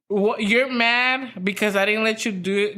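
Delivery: ordinary talk, nothing marked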